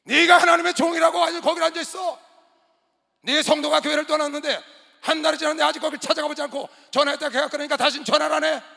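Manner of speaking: 훨씬 높고 크게 터뜨리는 고함; "네가"를 내리꽂으며 크게 호통치기; 폭발하는 꾸중. Retold or imitated. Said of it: "이게 내 속에 있는 게 폭발을 하는데요". (전도사를 전화로 야단치며 목청껏 호통)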